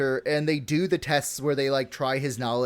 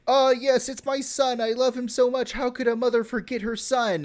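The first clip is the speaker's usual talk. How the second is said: higher, wavering mock old lady; old mother voice; 9 semitones higher than usual. Old mother claiming her son, high mock old-lady voice. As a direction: play the half-blind old mother, pitched up. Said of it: "and she just, like".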